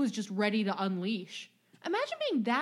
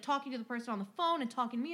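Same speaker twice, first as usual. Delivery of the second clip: ordinary delivery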